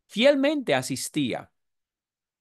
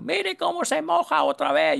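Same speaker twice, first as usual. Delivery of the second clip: mocking; 10 semitones higher than usual. Imitating Joe mocking baptism.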